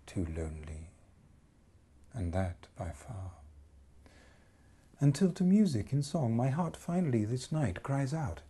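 dramatic poetry reading; poem recitation. Acted. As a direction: recite it like a love song spoken aloud. Whole piece a recited love poem.

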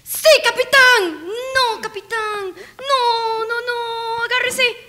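high shouted reply turning into a wail; high mock wail. Player answering the captain as Ricky slips, high wail. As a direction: call it out high, then wail the noes.

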